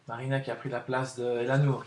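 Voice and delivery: normal talking voice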